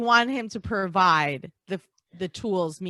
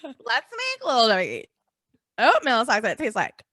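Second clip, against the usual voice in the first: eager pitch; 3 semitones higher, 8 dB louder than usual. Retold or imitated. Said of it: "they're like".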